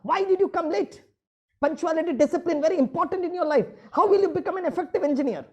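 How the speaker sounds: yüksek perdeden, yüksek sesle azarlayan öğretmen; geç kalan öğrenciye sinirle bağır; öfkeli bağırış. Retold or imitated. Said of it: "जब मेरे students late आते मैं भड़कता था".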